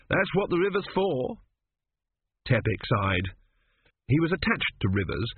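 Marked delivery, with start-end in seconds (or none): 0.1-1.4 breezy Arthur. Acted. Arthur answers sick friend.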